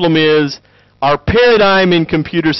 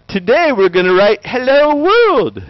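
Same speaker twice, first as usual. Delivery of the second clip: mock cheerful lecturer; 8 semitones higher than usual. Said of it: "they're like".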